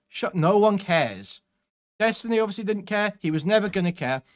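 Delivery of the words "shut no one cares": ordinary talk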